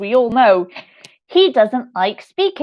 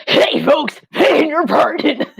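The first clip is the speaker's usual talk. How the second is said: higher, louder hammed-up Beetlejuice; Beetlejuice impression; 6 semitones higher, 4 dB louder than usual. Shown as a demonstration. Showing off her secret Beetlejuice impression to viewers, hammed up. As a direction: throw on a carnival-barker Beetlejuice. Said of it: "a pretty decent, uh, Beetlejuice impression".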